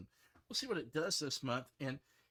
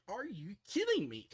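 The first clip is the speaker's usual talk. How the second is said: angry disbelief; 7 semitones higher, 5 dB louder than usual. Retold or imitated. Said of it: "it just starts making me really angry".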